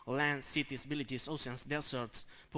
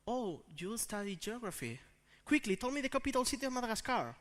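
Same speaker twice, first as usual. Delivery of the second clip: high, rapid quiz demand; spring the question on them, pitched up; pushy quizzer; 6 semitones higher than usual. Acted. Acquaintance putting a geography student on the spot, pushy.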